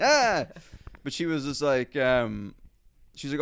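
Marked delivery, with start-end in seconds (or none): 0.0-0.5 short high yelp; hammed-up devil's recoil; mock scream. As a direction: yelp as if splashed with holy water. Mock reaction to holy water.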